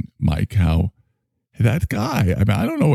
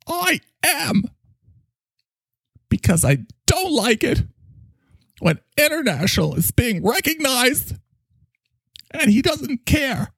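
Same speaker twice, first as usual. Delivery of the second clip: high, overwrought character voice; indignant sidekick. Acted. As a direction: protest in a high, wounded wail. Mike upset, indignant.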